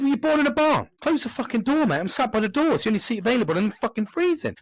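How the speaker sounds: telling off the man who left the door open, furious; angry shout. Retold or imitated. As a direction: shout him down across the café. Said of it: "I said".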